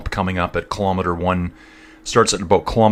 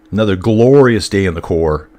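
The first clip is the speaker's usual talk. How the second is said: louder barking drill sergeant voice; 6 dB louder than usual. Retold or imitated. Quoting the Aliens drill sergeant to viewers, barked loud. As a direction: bark it like a parade-ground sergeant. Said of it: "and there's the drill sergeant".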